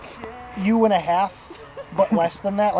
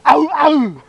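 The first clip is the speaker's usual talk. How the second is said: loud, high mock yelp; imitated catchphrase yelp; 6 semitones higher, 8 dB louder than usual. Storyteller imitating the younger guy's answer to everything, yelping. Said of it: "his onomatopoeia for everything, anything, was".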